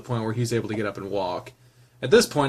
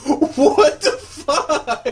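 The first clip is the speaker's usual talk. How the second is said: laughing disbelief; 5 dB louder, much slower than usual. Real, not acted.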